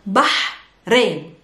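Bah with a long exhaled h, pause, then rain; breathy Arabic ha. Shown as a demonstration. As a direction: split Bah and rain slowly, air pushed on the h. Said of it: "There's a ha sound".

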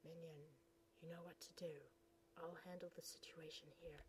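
soft whispered command; soft sinister whisper; hushed orders. Acted. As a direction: order the minion quietly.

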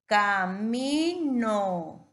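CAMINO very slow, each syllable held; drawn-out demonstration. Shown as a demonstration. Teacher helping a child name it, CAMINO drawn out. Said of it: "Te ayudo".

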